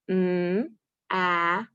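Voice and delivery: "Wa-ile" split into onset and rime; sounding out. Shown as a demonstration. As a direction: segment the word for the kids. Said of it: "Put your finger on the dot".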